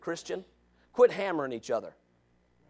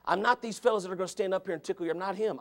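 normal talking voice

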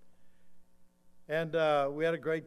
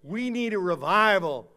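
loud, high-pitched pulpit proclamation; forceful preaching; 5 semitones higher, 15 dB louder than usual. Acted. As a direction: drive the warning home, stretching the final words.

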